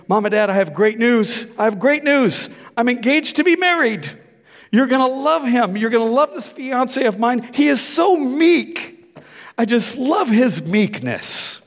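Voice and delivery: put-on high, bubbly young daughter voice; giddy daughter announcing her engagement; daughter's excited news. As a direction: gush like a thrilled daughter.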